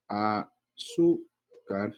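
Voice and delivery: "azúcar" drawn out slowly in separate syllables; teacher's slow breakdown of "azúcar". Shown as a demonstration. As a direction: sound out "azúcar" slowly, with gaps. Teacher sounds out example word.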